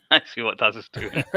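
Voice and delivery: amused, words riding on a laugh. Real, not acted. Reacting to a chat member's joke, laughing. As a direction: say it through real laughter.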